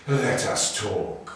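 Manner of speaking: the cobra threatens the mongoose; menacing cobra. A narrator's reading. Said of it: "he said".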